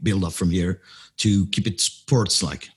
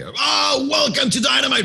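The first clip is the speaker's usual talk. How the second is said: shouting announcer. Retold or imitated. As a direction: belt it out like a ring announcer. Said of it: "I'm shouting everywhere".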